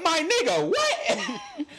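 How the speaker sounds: high, cracking, laughing "what?"; disbelief. Retold or imitated. Telling a friend about a coworker's excuse, high laughing disbelief. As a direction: squeal your disbelief through a laugh. Retelling coworker's absurd answer.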